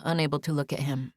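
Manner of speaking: ordinary delivery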